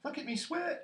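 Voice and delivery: put-on very high, loud child squeal; excited piping cry; squeaky Roo. A narrator's reading. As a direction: show off at the top of a tiny voice. Roo showing off in water.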